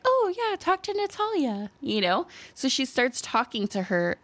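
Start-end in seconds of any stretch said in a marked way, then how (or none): 0.1-1.7 much higher, chirpy mock enthusiasm; eager parents. Parents urging friend to talk to Natalia, high eager. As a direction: sell it brightly like an eager host. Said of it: "And they're like".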